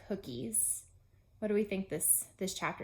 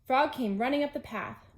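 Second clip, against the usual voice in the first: ordinary delivery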